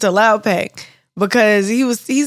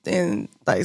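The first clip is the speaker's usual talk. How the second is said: ordinary talk, nothing marked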